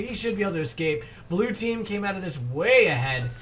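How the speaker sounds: excited esports caster calling a fight; energetic running fight commentary; live play-by-play. Real, not acted. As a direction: call the action fast and punchy as it unfolds.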